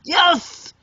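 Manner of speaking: high, bright cheer of victory; triumphant yes. Retold or imitated. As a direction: celebrate a small win. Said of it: "And I was like".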